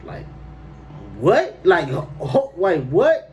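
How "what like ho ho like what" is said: loud, high-pitched disbelieving "what?"; stammering, indignant double take; incredulous. Retold or imitated. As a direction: react in stunned disbelief, voice jumping up. Reacting to her put-down.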